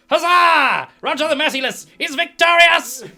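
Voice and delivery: high, very loud victory cry; triumphant shout. Acted. Dwarf proclaiming his win to the crowd, triumphant. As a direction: bellow a hammed-up proclamation of triumph.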